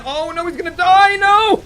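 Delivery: loud, high, hammed-up cry of alarm; mock panic. Streamer watching a player about to die, mock panic. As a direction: yell it high, building to a wail.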